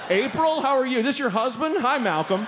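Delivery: high-pitched gushing introductions; happy greeting. Acted. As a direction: act delighted to meet fellow passengers. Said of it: "We're so happy we're meeting each other".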